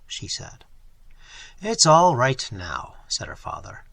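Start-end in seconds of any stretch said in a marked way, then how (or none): 1.6-2.9 "It's all right now" said slowly, each word weighted; emphasizing each word. A narrator's reading. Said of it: "emphasizing each word with a gentle tap".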